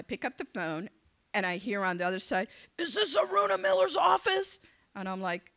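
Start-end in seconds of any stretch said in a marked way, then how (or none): 2.8-4.6 loud, high-pitched mock irate caller; angry caller. Angry caller phoning the candidate's office, imitated as high and irate. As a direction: play an irate man demanding an answer. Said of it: "I get this angry phone call".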